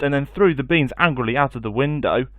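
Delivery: usual speaking voice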